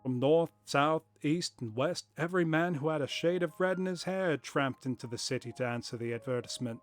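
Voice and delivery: lightly voiced, hammed-up corny accent for Jabez Wilson; corny put-on accent. Wilson telling Holmes about the crowd, in a corny put-on accent. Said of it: "it's kind of fun, though. I think I'll keep doing it".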